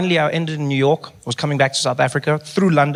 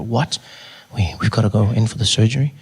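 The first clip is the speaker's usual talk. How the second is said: lightly lowered, private hum; confidential voice; 5 semitones lower than usual. Acted. Patient hearing about surgery, low confidential voice. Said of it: "speak in a confidential voice".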